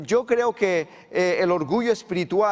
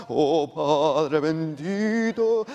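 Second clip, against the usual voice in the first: slow, drawn-out, showy holy tone; mock pious prayer voice; 3 semitones lower, much slower than usual. Pastor mocking showy prayers, in a slow mock-pious voice. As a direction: ham up a falsely devout prayer. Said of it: "cambia la entonación de su voz".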